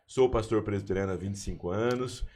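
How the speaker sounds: normal talking voice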